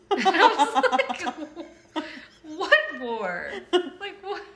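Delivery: high, loud, slow at first, laughing; can hardly get the words out; laughing while talking. Real, not acted.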